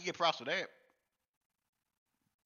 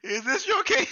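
much higher, louder jeering call; loud mock proclamation; 8 dB louder than usual. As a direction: shout it to the crowd. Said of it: "mock proclamation".

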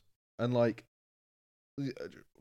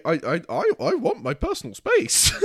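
high, loud, stammered plea; exasperated protest; 6 semitones higher, 8 dB louder than usual. Real, not acted. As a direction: protest comically, voice shooting up. Comic protest about being grabbed.

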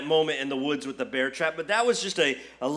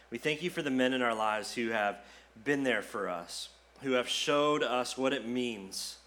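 soft, lower prayer voice, unhurried; 5 semitones lower, 4 dB quieter than usual. Acted. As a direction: pray over the dads gently. Pastor prays for the fathers.

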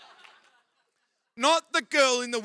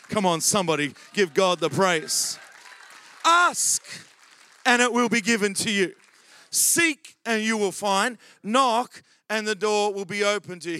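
preaching at full force; 7 dB louder than usual. Acted. Preacher shouting to the church to praise God. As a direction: lift the church with a booming call.

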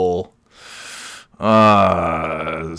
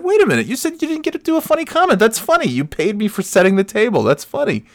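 fast, very high squeaky delight; high excited mock; faster than usual. Husband telling his wife her joke counts, high giddy.

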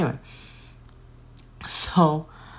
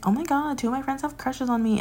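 higher, preening imitation; mock smug friend; 10 semitones higher than usual. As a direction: puff up like she's showing off. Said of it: "She was like".

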